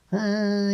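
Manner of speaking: high, drawn-out nasal "uh"; nasal singing noise. Shown as a demonstration. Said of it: "nasally, like".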